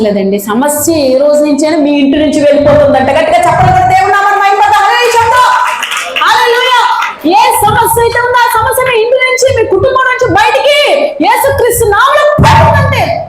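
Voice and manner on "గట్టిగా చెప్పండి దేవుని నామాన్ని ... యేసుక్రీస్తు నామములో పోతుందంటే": rousing shout. Real, not acted. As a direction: lift the crowd with a ringing cry. Preacher rousing congregation to respond.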